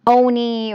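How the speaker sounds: "oni" said as one word, OH-nee; ticker read as word. Shown as a demonstration. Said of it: "however you wanna pronounce this ticker".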